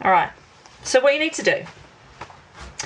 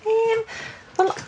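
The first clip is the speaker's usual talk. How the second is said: high Geordie hesitation "um"; full Geordie; 12 semitones higher, 3 dB quieter than usual. Shown as a demonstration. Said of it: "you could go full Geordie".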